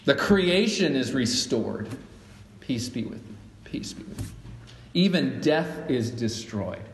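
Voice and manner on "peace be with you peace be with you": very soft, low murmur of blessing; hushed greeting. Acted. Worshipper passing the peace to a neighbor, hushed. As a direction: drop to a near-whisper, as if to one person.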